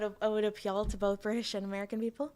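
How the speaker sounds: ordinary talk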